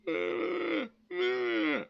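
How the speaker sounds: high-pitched mock whine; high, put-on fussing noise; mocking objection noise. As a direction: imitate complainers with a high squeal. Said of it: "people are gonna be like".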